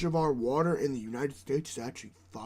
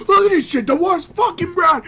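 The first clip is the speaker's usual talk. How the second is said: loud, high, furious yell; outraged shout; 12 semitones higher, 12 dB louder than usual. Retold or imitated. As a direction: shout it like you can't believe the water. Retelling an outraged complaint.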